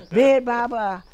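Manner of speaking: calling out Baba's name loudly, in a panic; retold shouting. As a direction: yell the name for help on a runaway horse. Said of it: "And I'm shouting at the".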